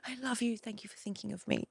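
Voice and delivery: gentle mock-sincere thanks; soft tender coo. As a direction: murmur it lovingly. Gush trails to a soft coo.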